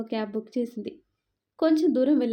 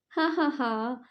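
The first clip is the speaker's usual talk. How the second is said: light teasing ha-ha-ha; playful laugh; 3 semitones higher, much slower than usual. Acted. Sneha laughing at Prashanth while he chases her, playful laugh. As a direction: laugh it off, a little out of reach.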